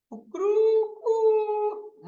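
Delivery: high, drawn-out cock-a-doodle-doo; rooster crow. Shown as a demonstration. Teacher showing vowel lengths with a rooster, crowing. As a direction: crow like a rooster with a long held ending. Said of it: "मुर्गा बोलता है".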